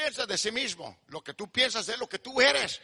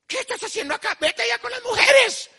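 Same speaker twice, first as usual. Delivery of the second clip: high-pitched scolding, swelling to a shout; mock scolding; 12 semitones higher, 6 dB louder than usual. Preacher plays a father sending his son away, mock scolding. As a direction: scold in a raised, indignant pitch.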